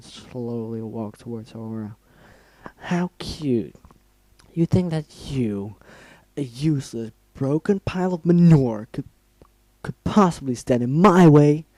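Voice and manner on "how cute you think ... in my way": mocking villain. Rainbow Dash taunting Aura before the kill, sneering menace. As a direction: taunt her like a deranged killer.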